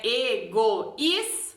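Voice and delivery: "egoísta" devagar, E-GO-ÍS-ta; tônica no hiato. Shown as a demonstration. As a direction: separe "egoísta" sílaba a sílaba, marcando o ÍS. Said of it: "a sílaba forte realmente está". (palavra silabada devagar)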